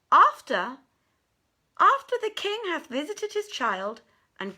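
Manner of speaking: a hint of a guarded palace eunuch, measured; stern eunuch. Eunuch voiced in the tale.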